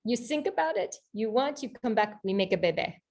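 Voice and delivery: put-on French-accented suitor, starting high and settling down; best French accent. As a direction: propose it simply in an imitated French accent. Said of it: "my best French accent".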